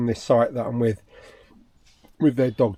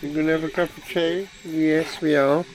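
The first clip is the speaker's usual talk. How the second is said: talking to a dog; 3 semitones higher, 3 dB louder than usual. Real, not acted.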